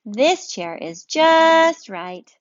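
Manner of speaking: a bear settling in happily; pleased bear, drawn out slowly; contented bear. Acted. Bear voiced in read-aloud story.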